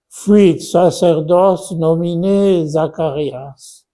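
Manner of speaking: slow, loud Latin line; model reading. Shown as a demonstration. Teacher reciting a Latin sentence to pupils. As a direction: read it out carefully for the class. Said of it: "teacher models Latin line".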